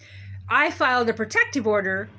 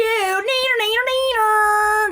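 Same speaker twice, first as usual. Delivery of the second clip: high, slow, sing-song mock taunt; taunting neener; 12 semitones higher, 6 dB louder, slower than usual. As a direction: tease like a playground bully. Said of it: "That's what Judge Remsen said".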